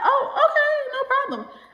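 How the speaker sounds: high, bright, taken-aback okay; mock surprised okay. Retelling a friend hanging up by being high and startled. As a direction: pop up high in fake surprise.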